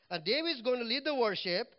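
usual speaking voice

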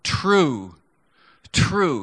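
each "True" punched loud, standing alone; emphatic declaration. Acted. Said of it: "so he just goes".

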